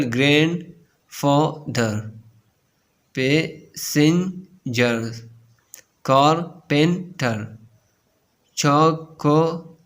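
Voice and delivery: very slow, a clear gap between syllables: "grand-fa-ther", "pas-sen-ger"; split into syllables. Shown as a demonstration. Teacher showing three-syllable words, split into syllables. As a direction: sound out "car-pen-ter" and "cho-co-late" in three separate beats. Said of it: "three syllable".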